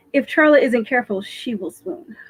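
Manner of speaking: ordinary delivery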